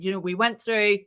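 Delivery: ordinary talk, nothing marked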